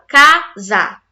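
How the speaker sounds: "casa" in two separate, emphatic beats; split into syllables. Shown as a demonstration. Teacher counting word pieces, "casa" syllable by syllable. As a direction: say "casa" as ca, sa with a clear gap. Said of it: "tem dois pedaços".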